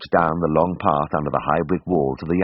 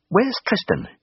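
high, harsh demand from an angry woman; rasped demand; slower than usual. A narrator's reading. Angry blonde demanding Tristan from Jim at the door, harsh and high. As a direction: spit out the question like a tough, furious woman. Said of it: "she rasped".

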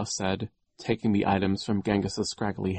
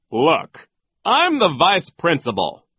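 high, loud self-importance; overbearing authority; pompous vice principal; 10 semitones higher, 10 dB louder than usual. Acted. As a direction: pull rank on the teachers. Voices Nero pulling rank.

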